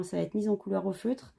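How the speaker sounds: normal talking voice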